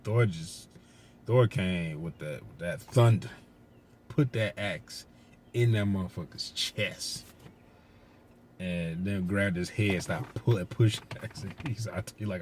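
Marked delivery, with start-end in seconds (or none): none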